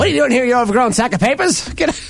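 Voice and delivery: put-on high, old-time insulting voice; hammed-up 1940s put-down; 1940s radio announcer. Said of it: "I love those nineteen forties insults".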